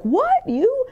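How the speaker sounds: shocked disbelief. Retold or imitated. People hearing she gets depressed, shocked high what you. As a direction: squeal it out in mock disbelief. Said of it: "They'll be like".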